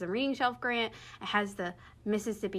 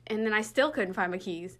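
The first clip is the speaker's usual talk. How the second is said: normal talking voice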